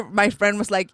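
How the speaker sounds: ordinary talk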